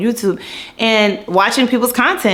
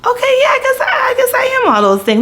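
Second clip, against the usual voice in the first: very high, sheepish okay; reluctant agreement. Retold or imitated. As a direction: squeak out a shy yes. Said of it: "And I was just like".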